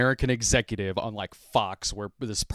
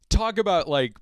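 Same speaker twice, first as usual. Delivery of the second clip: ordinary talk, nothing marked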